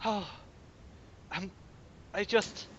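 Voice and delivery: hint of a fed-up wife walking out; despairing wife. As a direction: give up on him, weary and broken. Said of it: "turns back to him and goes".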